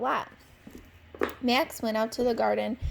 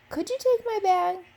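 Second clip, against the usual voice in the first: put-on old aunt asking; 9 semitones higher, 4 dB louder than usual. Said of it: "said Aunt Pat".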